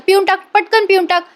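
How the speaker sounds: acted-out nagging to drink up; insistent coaxing. Two women press her to drink.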